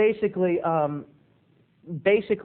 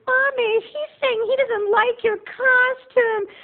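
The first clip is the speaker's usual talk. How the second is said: put-on very high, slow child voice; squeaky little kid tattling to mommy; whiny small boy telling on him to his mother; 3 dB louder, slower than usual. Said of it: "her little boy goes".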